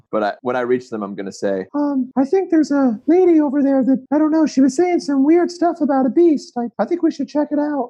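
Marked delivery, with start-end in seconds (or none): 1.7-7.9 high character voice. Acted. Player voices his character.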